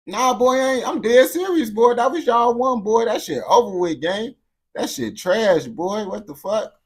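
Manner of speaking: high, loud, emphatic rejection; loud, worked-up dismissal; heated outburst. Real, not acted. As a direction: raise pitch and volume, insisting you mean it.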